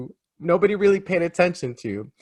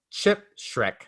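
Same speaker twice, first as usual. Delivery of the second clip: ordinary delivery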